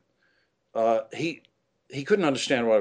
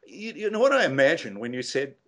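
higher, lightly voiced amazed trainee; 7 semitones higher than usual. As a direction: play the baffled trainee, higher. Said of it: "he said".

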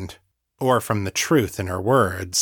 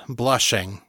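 ordinary delivery, nothing marked

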